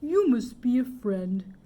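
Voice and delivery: lightly voiced bear, gentle; a hint of a tender toy bear. A narrator's reading. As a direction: say it with gentle joy.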